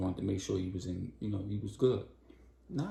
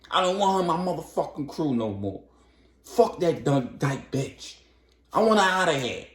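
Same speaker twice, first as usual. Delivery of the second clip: angry rant; 7 semitones higher, 5 dB louder than usual. Retold or imitated. Tony rages about Storm.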